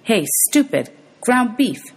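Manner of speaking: put-on a defiant boy taunting the monster; taunting shout. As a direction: goad the beast to charge.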